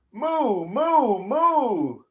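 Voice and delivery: high mock moo; mock cow moo for kids. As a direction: moo it out playfully.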